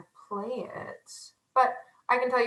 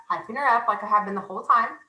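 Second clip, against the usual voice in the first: ordinary talk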